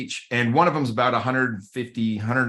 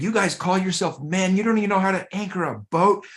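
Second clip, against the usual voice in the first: mock angry rant; 8 semitones higher than usual. Angry man berating the men's group leader at the boats, mock indignant rant. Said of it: "just going off".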